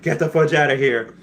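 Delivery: exaggerated incredulous exclamation; retelling his own stunned reaction; hammed-up shock; mock disbelief. I was like.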